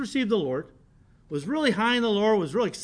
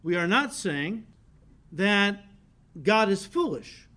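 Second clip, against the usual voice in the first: slow, each word weighed, God is foolish denied; slow careful stress; slower than usual. Shown as a demonstration. Preacher warning the congregation, slow and deliberate. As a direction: spell it out slowly so nobody mishears. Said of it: "Now be careful".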